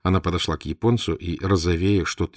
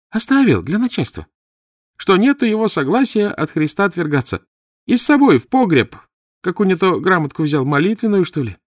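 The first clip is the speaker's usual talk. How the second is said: put-on very high, loud girlish voice; village girl; 13 semitones higher, 8 dB louder than usual. (высокий голос деревенской девушки)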